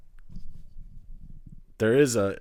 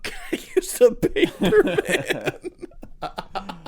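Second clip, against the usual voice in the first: high, mocking parody of a friend's question; mocking imitation; 11 semitones higher, 5 dB louder, slower than usual. Teasing his friend by parroting his question in a high mock voice. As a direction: throw his question back in a squeaky mimic.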